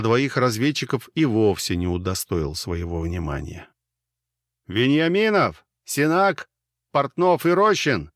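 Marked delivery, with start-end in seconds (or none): 4.7-8.1 loud, high call over engine noise; yelling over helicopter. A narrator's reading. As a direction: shout the names over roaring rotors. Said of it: "перекрикивая шум винтов". (крик сквозь шум винтов)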